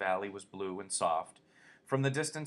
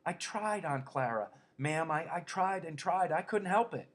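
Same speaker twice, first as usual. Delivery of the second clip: put-on higher pleading childlike voice; pleading simple man; 8 semitones higher, 4 dB louder than usual. A narrator's reading. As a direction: beg to be forgiven. Lenny pleads with Aunt Clara.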